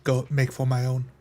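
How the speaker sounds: normal talking voice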